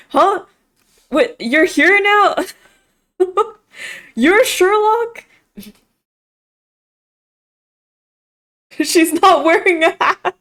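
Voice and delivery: high, slow giggly astonishment; can barely believe it, laughing; laughing disbelief. Real, not acted. Laughing disbelief at detective reveal.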